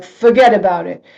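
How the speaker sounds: a loud Brooklyn New York "Forget about it"; Brooklyn accent. Retold or imitated. Preacher joking in a Brooklyn accent about letting go. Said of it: "you could have some, like".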